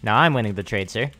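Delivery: ordinary talk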